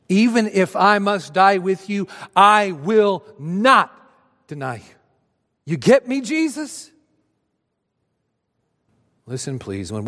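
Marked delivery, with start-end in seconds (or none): 0.1-6.9 Peter's fervent vow. Acted. Peter vowing to Jesus he will never deny him. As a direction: swear it with everything.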